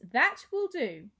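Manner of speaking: slow stern reprimand. A narrator's reading. Mother ending the girls' mockery, slow and stern. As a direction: lay it down slowly and sternly.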